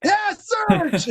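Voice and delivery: high, loud happy yell; excited shout. Real, not acted. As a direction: cheer his name out. Greeting friend joining call.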